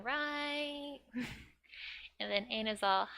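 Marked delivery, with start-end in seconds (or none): 0.0-1.0 "right?" lifted and stretched at the end; seeking agreement. Shown as a demonstration. Shopkeeper checking the customer's cake order, "right?" lifted for agreement. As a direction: tack on a long, higher "right?" to invite a yes. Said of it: "requesting for agreement".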